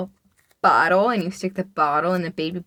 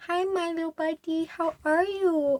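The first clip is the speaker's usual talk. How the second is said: pet talk; 6 semitones higher than usual. Acted. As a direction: coo to a beloved little pet. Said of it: "How do you talk to your animals".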